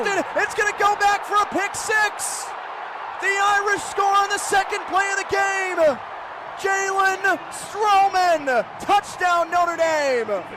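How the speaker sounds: high, loud, sustained play-by-play shout; ecstatic touchdown call. Real, not acted. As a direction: stretch the scorer's name in a roaring call. Live interception touchdown call.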